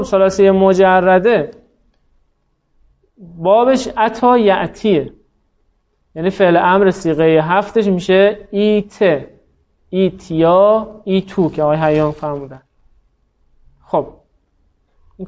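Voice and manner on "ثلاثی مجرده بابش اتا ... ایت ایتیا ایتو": slow, deliberate listing of the forms; slow teacher drill. Shown as a demonstration. Teacher to students, slowly spelling out the verb forms. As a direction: spell each form out slowly for the class. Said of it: "teacher spells out verb forms".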